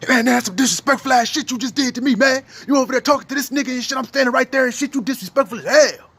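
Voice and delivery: man scolding his woman for flirting, mock angry; mock angry rant. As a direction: play the offended man going off on her. Acted angry man confronting woman.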